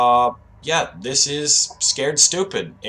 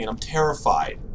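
ordinary talk

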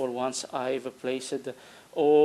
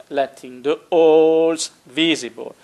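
says "holes visible" again, louder and firmer; louder self-correction; 3 semitones higher, 9 dB louder than usual. Real, not acted. Correcting his English for viewers, louder. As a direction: fix the slip out loud. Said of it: "Uh, my bad English".

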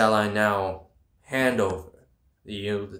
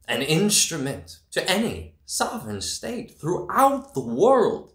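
high, heated declamation; climbing in passion, pitched high; impassioned rise; 8 semitones higher than usual. Acted. Rising defiant tirade.

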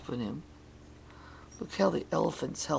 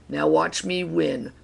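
ordinary delivery

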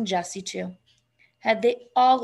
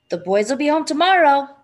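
raised, louder, to wake him; a little more loudly; 5 semitones higher, 7 dB louder than usual. A narrator's reading. Wife repeats it louder to wake husband. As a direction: say it louder so he wakes. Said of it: "and a little more loudly".

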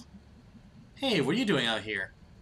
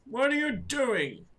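slow, high put-on old man; 9 semitones higher, slower than usual. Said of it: "give him, like, an old man voice".

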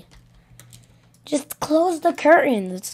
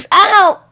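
pained yelp; 6 semitones higher, 5 dB louder than usual. Acted. Child hurt, yelps.

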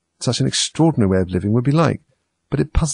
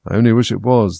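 usual speaking voice

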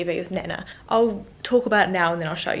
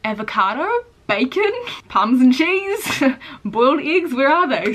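higher, louder, slow disbelieving questions, laughing; mock outrage at the missing toppings; counting off every missing topping in disbelief; incredulous listing; 5 semitones higher, 5 dB louder than usual. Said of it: "I was like".

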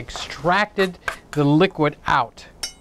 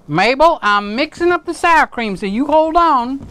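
louder, higher call to Mabel in the next room; calling over to Mabel to wait, raised voice; 6 semitones higher, 3 dB louder than usual. Real, not acted. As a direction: raise your voice so Mabel hears you.

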